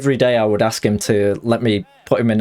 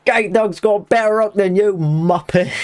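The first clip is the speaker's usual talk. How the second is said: shouted taunt; 9 semitones higher than usual. Real, not acted. Streamer taunting the rival fighter on stream, shouted jibe. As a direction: shout the insult at the opponent. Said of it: "Can't believe I just shouted that".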